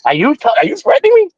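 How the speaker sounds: high, indignant retort; incredulous challenge. Retold or imitated. Adult answers a young boy's threat, incredulous and indignant. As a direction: act out disbelief with the pitch jumping up.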